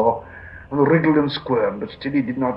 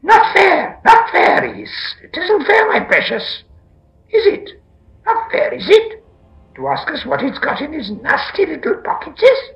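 shrill, loud creature protest; upset Gollum; 8 dB louder than usual. A narrator's reading. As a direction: screech the complaint very high and loud. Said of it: "he was frightfully upset".

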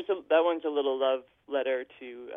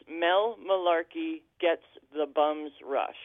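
slow, careful title; 2 semitones higher, slower than usual. Shown as a demonstration. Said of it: "I always say it way too quick".

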